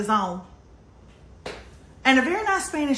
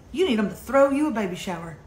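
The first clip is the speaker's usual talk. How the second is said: usual speaking voice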